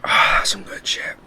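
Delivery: breathy whisper, slow and savoring; hushed, slow relish over candy. Real, not acted. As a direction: whisper it like a guilty pleasure. Savoring a Twizzler.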